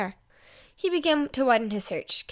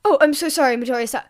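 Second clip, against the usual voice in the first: flustered apology; 2 semitones higher, 8 dB louder than usual. A narrator's reading.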